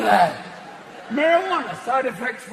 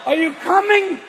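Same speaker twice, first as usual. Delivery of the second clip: mock dying yell; 8 semitones higher than usual. Partner asks, man dying.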